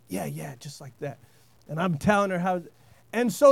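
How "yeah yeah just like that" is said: much softer, low murmured encouragement; soft coaxing. Retold or imitated. Husband coaxing his wife under the truck, soft murmur. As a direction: coax her along gently under your breath. Coaching wife softly at night.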